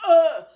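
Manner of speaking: loud high pained uh uh; pained whimper. Retold or imitated. Pulling out her own stud, a loud pained whimper. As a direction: whimper through the pain. Retold pain trying to pull stud.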